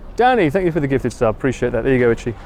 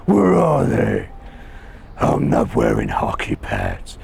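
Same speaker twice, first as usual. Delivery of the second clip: very low, slow growling vigilante; Batman; slower than usual. Acted. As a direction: drop into a deep, drawn-out superhero growl. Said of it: "You want me to be Batman?".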